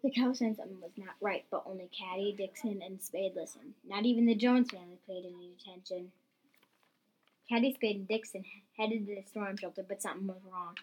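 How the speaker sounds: ordinary delivery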